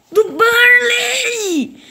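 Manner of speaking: high, very slow crying shout; anguished shout. Real, not acted. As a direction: wail it like a fresh wound. Repete a derrota gritando. (grito choroso e muito arrastado)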